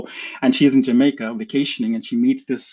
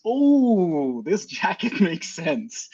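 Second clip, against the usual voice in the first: dawning realization; 5 semitones higher, much slower than usual. Retold or imitated. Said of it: "and then I realize".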